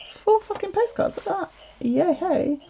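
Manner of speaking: high, hushed excited squeak; showing viewers the postcards she received; delighted squeal. Real, not acted. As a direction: show them off thrilled.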